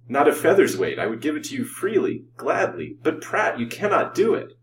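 high, fervent rush of feeling; eager excitement. A narrator's reading. Lover offers his fortune for the girl, excitedly. Rich man eagerly agrees, then doubts.

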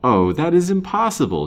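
high, loud, startled denial; aghast, voice jumping up; shocked disbelief. A narrator's reading. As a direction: burst out in disbelief. Shocked denial after stunned silence.

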